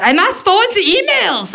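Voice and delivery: high, stiff German grandma voice. Retold or imitated. German grandma declaring her duty to forward emails, high and stiff. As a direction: announce it like a solemn duty. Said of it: "she thinks it's her job".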